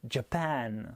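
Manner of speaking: "Japan" with a light first syllable and strong, long PAN; stress on second syllable. Shown as a demonstration. Teacher demonstrating word stress, second syllable of "Japan" punched. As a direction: lean on the end of "Japan". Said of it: "la deuxième qui est accentuée".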